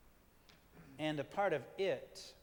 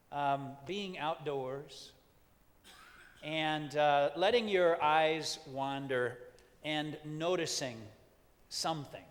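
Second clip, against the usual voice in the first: guided meditation; 3 dB louder than usual. Acted. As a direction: ease them into noticing, pause often. Leads an outdoor meditation.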